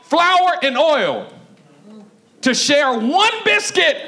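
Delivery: high, slow, acted outcry; hammed-up indignant wail, high-pitched; mad. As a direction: cry it out slowly, word by word. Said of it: "And she got mad".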